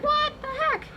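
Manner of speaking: high-pitched, incredulous joke voice; mock bewilderment. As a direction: squeal it in comic disbelief. Joke's victim, bewildered after beating.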